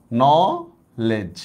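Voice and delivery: Knowledge stretched very slowly, syllable by syllable; drawn-out word. Shown as a demonstration. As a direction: draw out knowledge so each sound is heard. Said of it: "teacher drawing out the word". (Knowledge ধীরে টেনে উচ্চারণ)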